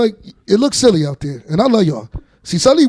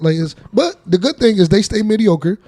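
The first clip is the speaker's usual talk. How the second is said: usual speaking voice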